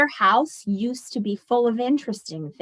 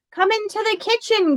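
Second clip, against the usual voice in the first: high, bright call from the kitchen; calling grandma; 12 semitones higher, 3 dB louder than usual. A narrator's reading. Grandma calling Rachel into the kitchen, high and loud. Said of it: "Grandma called".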